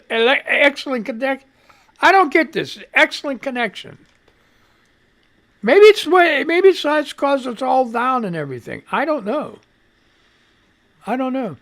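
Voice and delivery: high, loud and exasperated, at the end of his rope. Real, not acted. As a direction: pitch up in disbelief at the error. Stream tool fails, exasperated.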